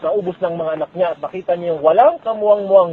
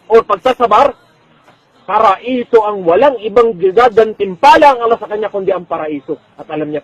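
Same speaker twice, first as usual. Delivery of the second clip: ordinary delivery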